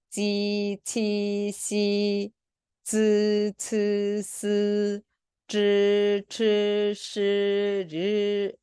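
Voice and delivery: drawn-out, level syllables; slow recitation. Shown as a demonstration. Teacher reading Chinese initials for students, slow and sustained. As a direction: chant each sound slowly on one held note. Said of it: "제가 천천히 한번 읽을".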